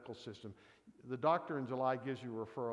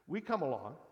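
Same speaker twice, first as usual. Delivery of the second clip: usual speaking voice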